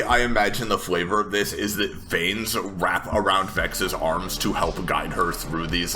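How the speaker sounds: ordinary talk, nothing marked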